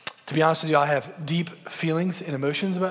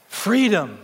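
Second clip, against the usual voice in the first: exultant cry; 5 semitones higher, 6 dB louder than usual. Retold or imitated. Andy in the rain, arms raised.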